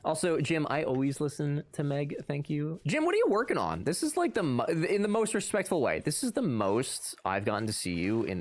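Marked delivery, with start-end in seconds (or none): none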